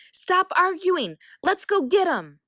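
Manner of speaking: high and loud battle call; rally them, yelling over the noise; urgent shout. A narrator's reading. Zeus calling siblings to battle.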